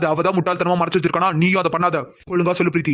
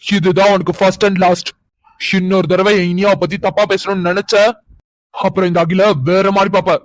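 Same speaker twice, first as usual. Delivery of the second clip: menacing warning; 2 semitones higher, 6 dB louder, slower than usual. Acted. Final warning to girl.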